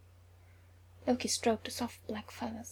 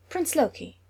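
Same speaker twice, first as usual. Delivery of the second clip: sharp, higher and louder call of the name; 6 semitones higher, 5 dB louder than usual. A narrator's reading. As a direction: snap his title to get his attention. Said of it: "she said sharply".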